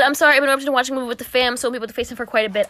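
rattled off quickly; fast mock read. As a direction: rush through the message. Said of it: "He said".

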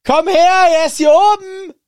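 very high, loud shout down the house; calling out. Retold or imitated. Hermine shouting down to Ron that Harry is upstairs, very high loud call. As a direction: yell for him to come up here.

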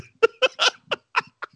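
squealing laughter; real laugh; high laugh. Real, not acted. Cracking up at his quote.

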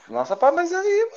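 high drawn-out amazement; amazed. Real, not acted. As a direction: let the surprise stretch out slow. Amazed at friend who sings.

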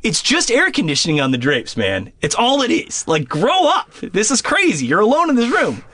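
high, tense self-scolding; nervous high voice telling yourself to grow up; scolding himself. Retold or imitated. Telling himself it's only the air conditioning, tense and high. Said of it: "And I'm like".

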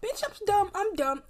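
high self-mocking squeal; laughing at herself in a high voice. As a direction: squeak it, pretending to be clueless. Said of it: "and I was like".

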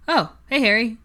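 high, loud startled hello; surprised greeting. A narrator's reading. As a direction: caught off guard, greeting a friend. Ron surprised to see them.

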